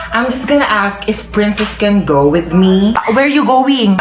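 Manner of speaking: slow, lightly American English; American accent. Acted. American mother asking to take the daughter, in an American accent. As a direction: play the visiting American mom.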